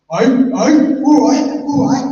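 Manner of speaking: loud, high London-accented shout. Shown as a demonstration. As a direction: blurt it out loudly in a London accent. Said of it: "can't with a London accent".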